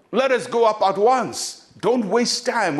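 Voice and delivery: rally them to move at once; urging quick action. Retold or imitated. Caleb to the people, urgent. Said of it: "urgent action".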